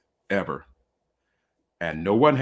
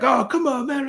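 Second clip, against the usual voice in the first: hammed-up high-pitched pleading; mock yelling protest. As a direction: imitate rowdy guests protesting in a thin high cry. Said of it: "they're yelling and screaming".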